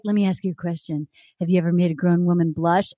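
low voice for a father's question; imitating dad. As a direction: drop down low to play dad. Voicing her father's question.

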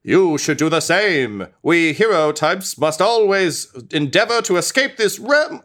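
proud hero speaking grandly; skeleton hero voice. Acted. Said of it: "he says".

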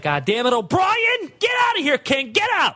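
high-pitched exasperated yell; mock angry shout. Showrunner throwing drunk guests off the set, high mock-angry shouting. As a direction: holler at them to get out. Acting out bosses kicking guests out.

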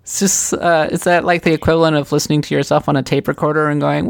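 ordinary delivery

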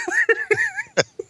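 squealing high laugh; high burst of laughter. Real, not acted. Host cracking up about rude texts, high laugh. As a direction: break into a shrieking laugh.

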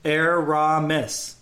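"Aramis" drawn out slowly as air-RAH-mis; slow "Aramis", leaning on the middle; stress on RA. Shown as a demonstration. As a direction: sound out "Aramis" syllable by syllable. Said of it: "Ra is the".

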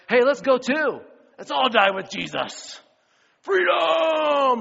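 rising to a huge, high shout of Freedom; rally them like William Wallace charging; fired-up battle cry. Retold or imitated. Thomas rallying the disciples, a roaring battle cry.

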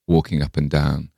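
plain reading voice

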